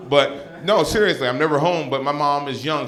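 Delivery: ordinary talk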